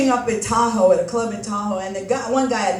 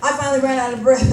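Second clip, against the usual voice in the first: ordinary talk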